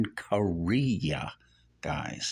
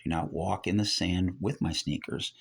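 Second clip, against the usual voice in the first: normal talking voice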